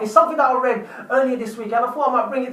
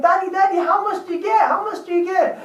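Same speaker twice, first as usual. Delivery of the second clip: put-on nagging child; 7 semitones higher than usual. Son nagging father for his wage.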